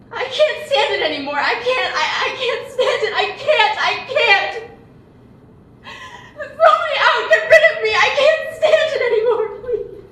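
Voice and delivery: high, loud, half laughing, half sobbing; hysterical outburst. Acted. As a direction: break down and let it all spill out in a loud hysterical rush. Sister asks what's the matter.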